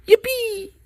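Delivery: high, sing-song yippee; playful yippee. Real, not acted. Gardener repeating a word he loves, playful high yippee. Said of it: "I like saying that word".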